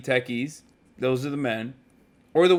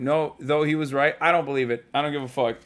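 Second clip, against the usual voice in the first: normal talking voice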